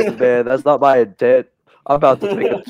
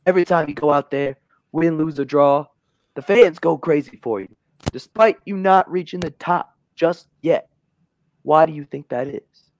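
lightly higher, pushy interviewer; a hint of a brash sports host; mildly hammed-up TV host; Stephen A. Smith; 6 semitones higher than usual. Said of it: "And Stephen A. Smith says".

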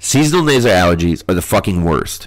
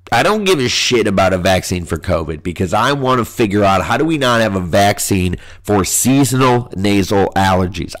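ordinary talk, nothing marked

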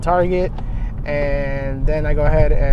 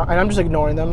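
ordinary delivery

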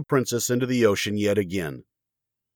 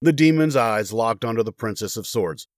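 ordinary delivery, nothing marked

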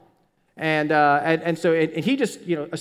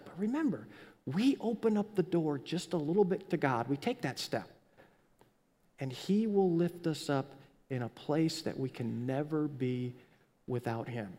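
soft appeal; 3 semitones lower, 7 dB quieter than usual. Real, not acted. Quiet tender appeal.

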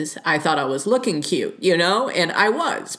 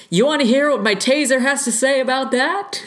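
much higher, mock menacing taunt; sassy threat; 9 semitones higher, 4 dB louder than usual. A commenter quoting what she tells catcallers, in a high mock threat. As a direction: tease the catcaller with a taser threat. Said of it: "somebody commented and was like".

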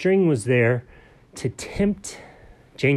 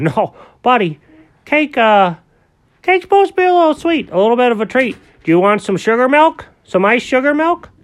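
higher, louder friendly grown-up character; Alfred's kindly voice; 12 semitones higher, 8 dB louder than usual. Acted. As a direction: talk brightly to a little buddy. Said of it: "And Alfred said".

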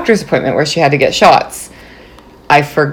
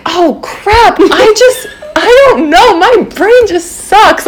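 much higher, laughing, mock-ditzy excuse; hammed-up innocence; 14 semitones higher, slower than usual. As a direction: play dumb and overdo the confusion. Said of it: "I'm just playing this up".

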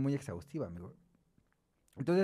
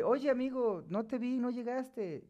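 mock friend asking; 9 semitones higher, slower than usual. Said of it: "después te dice".

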